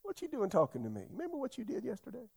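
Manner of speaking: quick, soft mock reproach rising high; fast, high-pitched accusing questions, put on; imagined scolding God. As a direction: play God holding a grudge, hurried and hushed. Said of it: "you could hear him say".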